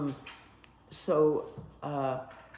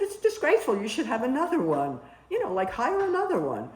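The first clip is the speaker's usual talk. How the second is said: high, louder indignant protest; retold indignation; 8 semitones higher, 3 dB louder than usual. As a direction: replay the outrage at the boss. Complaining to head of nursing.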